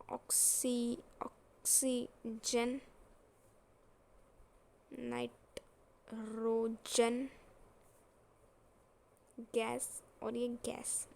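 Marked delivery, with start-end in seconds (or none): 0.1-7.3 slow, loud, syllable by syllable; drawn-out emphasis. Shown as a demonstration. Teacher showing students a shared pattern, slow and emphatic. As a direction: break each element name into stretched syllables.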